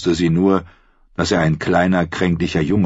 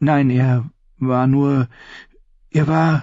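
put-on higher, halting young voice; timid girl; 9 semitones higher, slower than usual. A narrator's reading. Sansa blushing as she answers her aunt, hesitant. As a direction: stammer it out, embarrassed.

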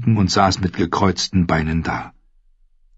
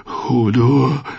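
higher, drawn-out uneasy question; touch of fear; 5 semitones higher than usual. A narrator's reading. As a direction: let a little fear into the voice. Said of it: "In Hodors Stimme schwang Angst mit". (leicht ängstlich)

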